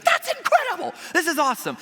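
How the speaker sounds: very high, loud, mock amazement; over-the-top happy. Attendant reacting to guests' anniversary news, over-the-top happy. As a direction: exclaim with hammed-up Disney glee. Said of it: "he's way over the top happy".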